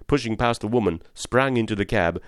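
plain reading voice

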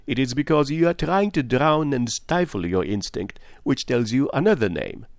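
Poirot. Acted. Detective exposes a woman's hidden suspicion, emphatically. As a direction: drive the accusation home.

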